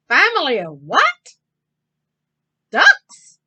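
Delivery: loud, rising to very high on Ducks; disbelieving question. Acted. Clancy hears of ducks in the street, loud and astonished. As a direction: shock on the last word.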